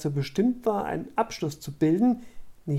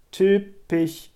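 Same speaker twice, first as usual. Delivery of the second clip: dismissive interjection, raised voice; interrupting; 3 semitones higher, 5 dB louder than usual. A narrator's reading. Scarlett cuts Tuna off mid-sentence, loudly dismissive. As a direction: cut in with a louder jab. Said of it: "unterbrach sie Scarlett".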